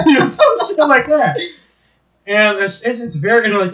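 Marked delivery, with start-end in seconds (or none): 0.0-1.4 high burst of laughter then laughing words; laughing hard at his own joke; real laughter. Real, not acted. Friend cracking up at his own crude joke, laughing.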